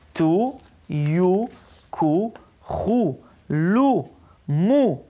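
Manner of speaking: long, drawn-out oo on each syllable; slow teacher's reading drill. Shown as a demonstration. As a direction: recite each syllable slowly, separated by pauses. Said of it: "אנחנו עכשיו נקרא את כל אותיות".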